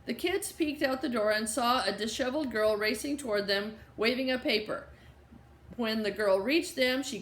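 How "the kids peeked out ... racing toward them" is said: ordinary delivery, nothing marked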